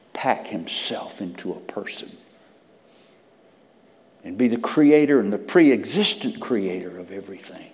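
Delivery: ordinary delivery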